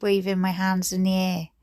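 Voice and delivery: usual speaking voice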